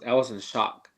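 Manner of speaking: usual speaking voice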